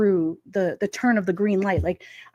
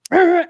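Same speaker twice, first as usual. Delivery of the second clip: loud, high horn-like blare; imitated car horn; 8 semitones higher, 7 dB louder than usual. Drivers blaring at a green light, imitated horn. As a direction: mimic an impatient honk with the voice.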